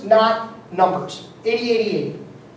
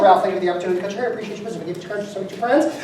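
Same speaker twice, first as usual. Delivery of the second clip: rushed thanks; 2 semitones lower, 3 dB quieter, much faster than usual. Retold or imitated. Barber thanking client Ralph.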